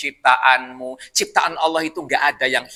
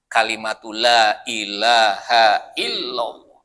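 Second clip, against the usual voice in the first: intoned creed; 7 semitones lower, much slower than usual. Acted. Religious formula intoned.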